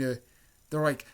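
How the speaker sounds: ordinary delivery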